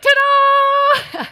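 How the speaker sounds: put-on very high, loud shouting kid; kid's shouted ta-da. Dad voicing son's final ta-da, shouted high. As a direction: shout it like a boy leaping onto the ottoman. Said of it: "He's like".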